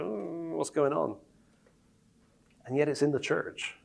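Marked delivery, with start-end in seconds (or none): 0.0-1.2 soft doubtful question; quiet puzzled mm. Retold or imitated. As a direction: murmur your confusion. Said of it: "And you go".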